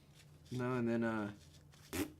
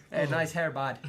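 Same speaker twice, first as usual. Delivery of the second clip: put-on Canadian accent; 4 semitones higher, 8 dB louder than usual. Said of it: "has a Canadian accent".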